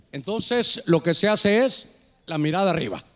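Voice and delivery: ordinary talk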